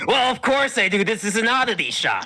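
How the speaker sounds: high, loud merchant voice; shopkeeper voice. Acted. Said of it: "he says".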